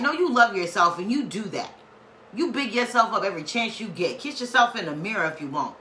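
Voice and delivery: usual speaking voice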